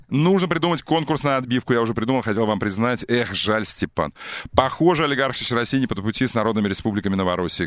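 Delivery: usual speaking voice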